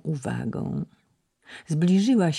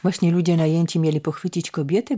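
usual speaking voice

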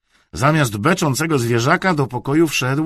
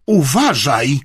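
loud, sharp caution; stern warning; 4 semitones higher, 7 dB louder, slower than usual. A narrator's reading. Aunt to her nephew, warning sternly. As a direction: warn with raised voice. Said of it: "pogroziła Bartusiowi palcem".

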